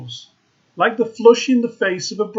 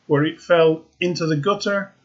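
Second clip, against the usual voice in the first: plain reading voice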